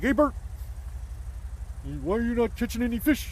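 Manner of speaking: high, bright puppet voice asking a friend; Ernie voice. Retold or imitated. Ernie teasing Bert in the boat, high puppet voice. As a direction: squeak it up like a Muppet. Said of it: "And he's like".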